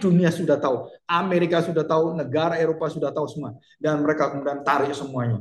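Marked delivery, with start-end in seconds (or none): none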